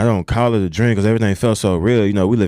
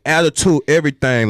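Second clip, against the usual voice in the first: usual speaking voice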